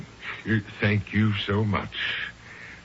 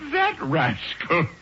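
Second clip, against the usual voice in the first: high, slow, chuckling verdict; amused relish; 7 semitones higher, slower than usual. Acted. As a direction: savour it with amusement. Laughing about Cuthbert.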